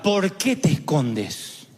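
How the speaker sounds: low, slow accusing question; a slow, low reproach; accusing God. Retold or imitated. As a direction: drop low and drag it out, reproachful. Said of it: "no pregunta".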